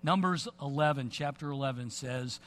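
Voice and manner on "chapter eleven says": ordinary delivery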